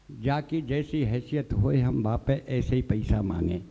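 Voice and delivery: slow, low pleading beggar's voice; humble beggar. Retold or imitated. Beggar voiced with folded hands. (धीमी, झुककर विनती करता भिखारी)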